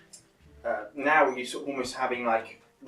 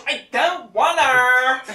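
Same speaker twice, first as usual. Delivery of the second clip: whiny refusal; 12 semitones higher, 9 dB louder, much slower than usual. Acted. Reluctant girl whining.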